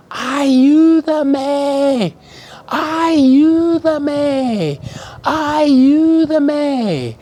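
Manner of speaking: high, loud, long cry of "ayúdame"; screamed loud. Retold or imitated. Stranded on deck, shouting for help, loud long calls. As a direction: call "ayúdame" out over the music. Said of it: "So I screamed loud".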